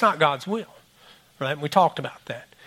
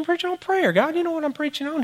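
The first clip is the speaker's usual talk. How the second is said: much higher, defensive protest; indignant; 10 semitones higher, 3 dB quieter than usual. Retold or imitated. Pastor protesting to God much higher, indignant. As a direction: bristle at the question.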